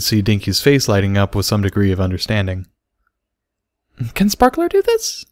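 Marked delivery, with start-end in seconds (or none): 4.1-5.3 put-on very high little girl question; high childlike voice; filly voice. A narrator's reading. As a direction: ask it wide-eyed.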